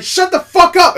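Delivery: high, loud exasperated yell; pissed-off shout. Retold or imitated. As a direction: re-enact yelling at spoilers. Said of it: "You were shouting".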